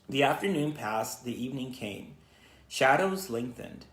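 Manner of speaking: usual speaking voice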